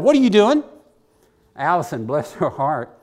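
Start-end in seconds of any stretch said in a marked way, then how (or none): 0.0-0.6 sharp loud call from the other room; mock shout. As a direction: catch them red-handed, loud and high. Catching kids sneaking into cabinets.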